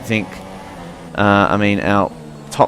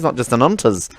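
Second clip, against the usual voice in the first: mock Kiwi accent; 7 semitones higher than usual. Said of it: "Now I'm speaking like a Kiwi brew".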